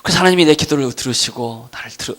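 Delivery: ordinary talk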